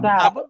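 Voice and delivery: "Tab" high and loud with open ah. Shown as a demonstration. Teacher repeats word after class, open a. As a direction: punch out "Tab" with Patois ah. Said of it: "gives you the sound a".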